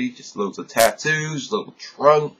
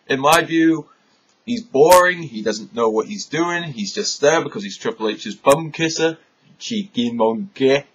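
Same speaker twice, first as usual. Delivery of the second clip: normal talking voice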